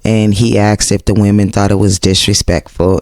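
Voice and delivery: usual speaking voice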